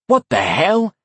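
loud, high outraged shout; angry yell. A narrator's reading. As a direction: yell at the orc. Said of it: "I yelled angrily".

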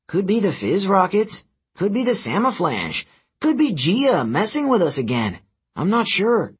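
put-on worried young tinkerer; fretting mechanic. Mechanic lists causes.

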